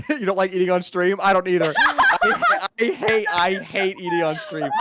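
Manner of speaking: loud laughing complaint. Real, not acted. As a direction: laugh through every word. Laughing about eating on stream.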